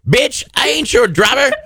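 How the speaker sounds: normal talking voice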